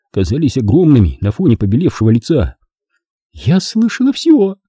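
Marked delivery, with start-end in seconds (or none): 3.3-4.6 put-on high, slow little-girl voice; childlike voice. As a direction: speak like a small child. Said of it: "совершенно детским голосом".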